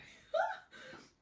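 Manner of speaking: a high, teary laugh that breaks toward crying. Real, not acted. Vlogger laughing through tears over the show's ending. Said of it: "I'm gonna get emotional right now".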